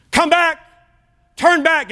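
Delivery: high, loud shouted plea; calling out. Acted. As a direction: shout it as the Father calling someone back. Acting Heavenly Father calling out.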